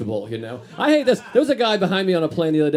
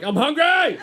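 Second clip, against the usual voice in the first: mock hungry shout; 12 semitones higher, slower than usual. A passenger announcing to the plane that he is hungry, in a mock shout. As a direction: blurt it out so the whole cabin hears. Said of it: "I like to let people know when I'm hungry".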